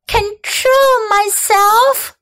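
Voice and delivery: put-on high, slow outraged girl's cry; indignant high child's yell. A narrator's reading. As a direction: fling his words back at him. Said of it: "yelled Fern".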